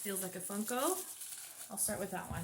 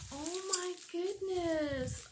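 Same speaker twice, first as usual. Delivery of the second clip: touched gasp; 8 semitones higher, slower than usual. Real, not acted. Moved by gift.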